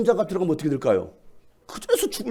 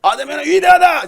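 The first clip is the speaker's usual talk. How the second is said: high, loud chant to start; leading shout; 8 semitones higher, 6 dB louder than usual. Shown as a demonstration. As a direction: lead the cry for everyone. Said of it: "대표로 하는 거예요".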